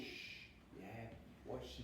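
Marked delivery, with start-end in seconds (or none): 0.0-0.5 put-on soft shh to a baby; quiet soothing shh; shushing. Shown as a demonstration. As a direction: hush the baby gently. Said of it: "So you're saying".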